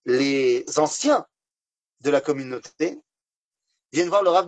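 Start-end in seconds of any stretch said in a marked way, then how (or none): none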